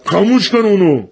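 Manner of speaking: sharp high shout across the shelter; angry shout. Acted. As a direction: yell at him to put the lighter out. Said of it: "یکی داد زد".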